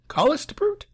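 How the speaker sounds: high rising tallest brute?; puzzled question. Shown as a demonstration. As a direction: ask tallest brute? in disbelief. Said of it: "repeating line questioningly".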